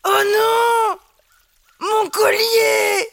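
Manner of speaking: a lightly voiced sad werewolf. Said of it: "dit-il d'une voix de loup-garou triste".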